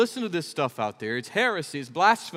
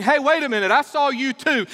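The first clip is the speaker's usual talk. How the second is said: higher, louder sudden recognition; accusing bystander; 9 semitones higher, 6 dB louder than usual. Retold or imitated. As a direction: catch him out. Another accuser in courtyard.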